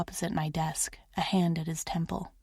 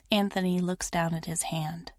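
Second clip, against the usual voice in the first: plain reading voice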